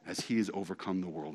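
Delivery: usual speaking voice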